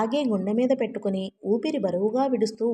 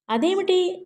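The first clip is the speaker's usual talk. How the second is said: higher-pitched startled question; taken aback; 8 semitones higher than usual. A narrator's reading. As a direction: ask it in stunned surprise. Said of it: "అన్నదామె నివ్వెరపోయి". (నివ్వెరపోయి)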